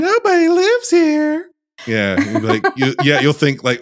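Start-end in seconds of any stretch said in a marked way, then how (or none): 0.0-1.5 put-on very high, slow eerie child voice; creepy little girl. Podcaster voicing a haunted little girl, high slow eerie. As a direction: drag it out like a ghost child.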